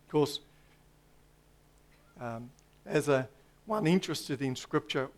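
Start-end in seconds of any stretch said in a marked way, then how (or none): none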